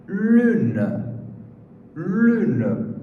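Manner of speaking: Lune, lips rounded for the French u; French word lune demo. Shown as a demonstration. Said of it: "como é que se pronuncia essa palavra".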